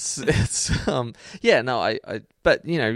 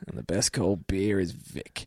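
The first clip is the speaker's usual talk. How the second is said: beer ad voiceover; 2 semitones lower, 6 dB quieter, slower than usual. Retold or imitated. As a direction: draw it out like an old beer advert. Imitates old beer commercial voiceover.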